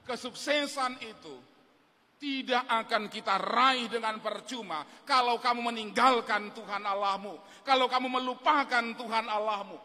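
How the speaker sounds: loud, urgent sermon appeal; preaching at full force. Acted. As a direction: press the young listeners to choose God. Preaching to the young people. (seruan khotbah yang lantang dan mendesak)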